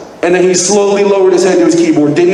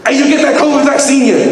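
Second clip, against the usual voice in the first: mock stranger's call; 7 semitones higher than usual. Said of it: "he starts yelling at us".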